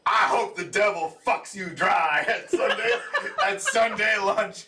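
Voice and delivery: can't keep a straight face quoting him; cracking up retelling the line; laughing through quote. Retold or imitated. Quoting a TV insult while laughing.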